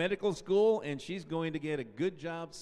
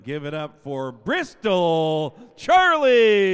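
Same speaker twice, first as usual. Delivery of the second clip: big louder call to the crowd; rally the gym for her; announcer call; 2 semitones higher, 13 dB louder, slower than usual. Real, not acted. Announcer asks the crowd to cheer, loud call.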